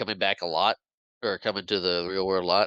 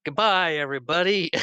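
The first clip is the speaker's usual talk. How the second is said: high, loud singsong goodbye; playful imitation of a gleeful send-off; mock farewell call; 8 semitones higher, 4 dB louder than usual. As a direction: call it out as if flying away. Character calls out while flying off.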